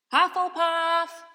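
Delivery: slow, high, loud booming hat; hat shouting. A narrator's reading. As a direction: bellow the house like a magic hat. The Sorting Hat announces house.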